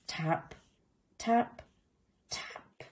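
hushed taps dying away; soft tapping. A narrator's reading. Narrator voices little tooth tapping, soft and fading. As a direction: let each tap fade into a whisper. Imitating sound inside eggs, softly.

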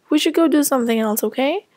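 usual speaking voice

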